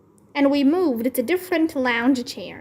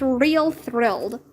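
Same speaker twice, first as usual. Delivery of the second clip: ordinary delivery, nothing marked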